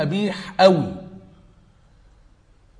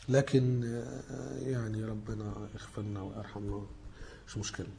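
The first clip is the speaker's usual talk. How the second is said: very soft, low murmur; resigned aside; 6 semitones lower, 14 dB quieter than usual. Real, not acted. Lecturer asking God's mercy after criticising the book, hushed resigned aside. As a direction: drop right down to a subdued, low aside, almost to yourself.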